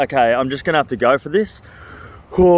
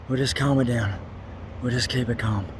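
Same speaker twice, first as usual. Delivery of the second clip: usual speaking voice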